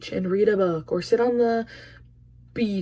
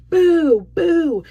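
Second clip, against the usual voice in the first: high, loud playful boo; mock booing; 7 semitones higher, 7 dB louder than usual. Booing people who throw clothes away, in a high loud jeer. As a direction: jeer at the landfill crowd.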